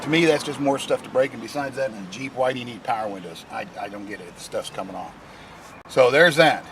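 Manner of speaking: usual speaking voice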